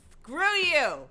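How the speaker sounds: high, loud playful shout; mock shout. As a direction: yell it in mock outrage. Yelling at YouTube.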